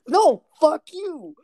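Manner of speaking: loud, high, drawn-out mock outburst; ballistic. Angry kid rejecting the joke excuse, imitated ballistic yell. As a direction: explode like a kid who won't be calmed. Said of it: "it was a ballistic moment".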